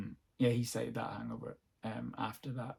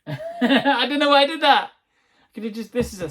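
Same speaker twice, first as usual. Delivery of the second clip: laughing; 11 semitones higher, 12 dB louder than usual. Real, not acted. Laughing at own notes mistake.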